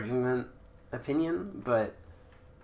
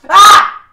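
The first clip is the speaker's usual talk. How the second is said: shrill very loud scream; battle scream; 28 dB louder than usual. Real, not acted. Gamer screams to power up attacks, shrill battle scream. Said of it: "You have to scream".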